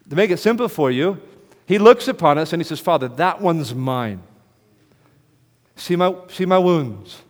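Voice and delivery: loud, high, ringing preacher's cry; full-force preaching. Acted. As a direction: thunder it from the pulpit, slowing on key lines. Preaching Jesus' plea.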